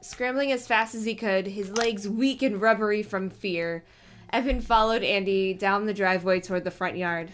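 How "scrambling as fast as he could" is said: ordinary delivery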